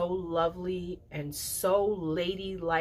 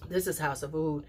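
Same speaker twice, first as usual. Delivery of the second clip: ordinary talk, nothing marked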